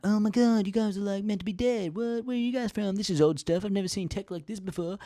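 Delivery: high, loud gushing wonder; mock amazed kids. Kids discovering an ancient crew, high mock amazement. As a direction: play starstruck kids.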